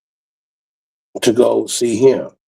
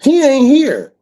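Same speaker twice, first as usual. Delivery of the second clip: high, loud mock woman's voice; quoted mama; 13 semitones higher, 4 dB louder, slower than usual. His mama answering the door, high loud mock voice. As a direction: imitate a mother's high, curt answer. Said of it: "That was his mama".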